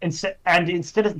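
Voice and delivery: normal talking voice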